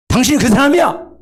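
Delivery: high, loud accusing voice; accusing confrontation. Shown as a demonstration. Nathan confronting David, loud and accusing. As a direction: point the finger like Nathan to David. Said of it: "이렇게".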